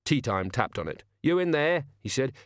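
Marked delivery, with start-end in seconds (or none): 1.2-1.8 higher, lightly voiced call through a door; coaxing villain. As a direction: coax someone out, politely. Villain calls through closed door.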